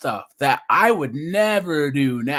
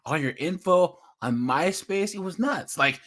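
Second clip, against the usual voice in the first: ordinary talk, nothing marked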